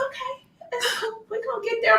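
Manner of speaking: high, soft, sleepy mumbling; sleepy husband voice. Retold or imitated. Husband on the couch stalling his wife, drowsy high whine. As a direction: play the drowsy husband, half crying. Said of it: "And he's like".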